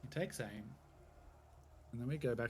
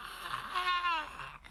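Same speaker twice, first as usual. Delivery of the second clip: high piercing bird shriek; crow screech; 5 dB louder than usual. Retold or imitated. The GM voices a crow screaming in the distance, high piercing shriek. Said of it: "giant scream and this screech".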